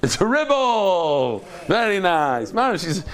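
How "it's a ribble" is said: slow, drawn-out delighted echo; playful echo. Real, not acted. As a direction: stretch out the joke word, falling slowly. Playfully echoes a student's joke word.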